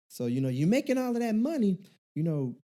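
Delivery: high-pitched preachy auntie. Retold or imitated. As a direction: warn him like a nosy aunt. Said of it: "this is my auntie voice".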